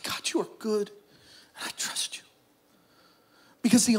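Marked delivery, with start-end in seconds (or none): 0.0-2.2 whispered through tears; barely voiced, choked prayer; tearful whispered prayer. Real, not acted. Father praying in a whisper while his son is resuscitated. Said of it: "it's that cry where snot's running".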